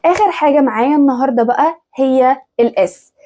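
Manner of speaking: ordinary talk, nothing marked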